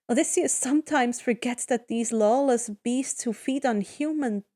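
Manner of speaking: usual speaking voice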